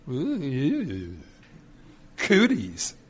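drawn-out, squeamish ooh; playful disgust; mock squeamish. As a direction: recoil from shared germs, playfully. Squeamish reaction to sharing a cup.

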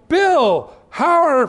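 high, loud acted hail, cut short; hearty greeting.

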